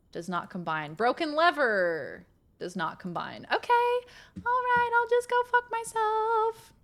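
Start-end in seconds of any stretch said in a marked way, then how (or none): 3.5-6.7 high, held monotone of mock resignation; mock-defeated singsong. Gamer to herself after nothing combines, high mock-defeated singsong.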